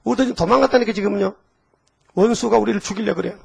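normal talking voice

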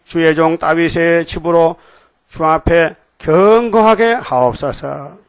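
slow, declamatory prayer reading with drawn-out words; solemn scripture reading. Acted. Preacher reads David's prayer to the congregation, solemn and slow. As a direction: read it out formally, stretching key words.

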